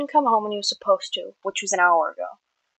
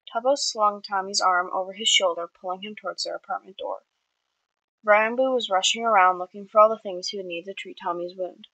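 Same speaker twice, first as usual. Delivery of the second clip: usual speaking voice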